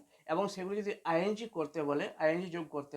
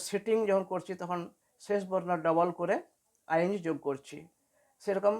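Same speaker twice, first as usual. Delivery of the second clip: normal talking voice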